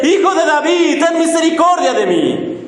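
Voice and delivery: high, pleading outcry. Retold or imitated. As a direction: beg at the top of the voice. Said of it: "comienza aún a gritar más fuerte".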